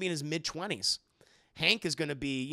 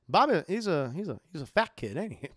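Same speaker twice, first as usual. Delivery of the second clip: normal talking voice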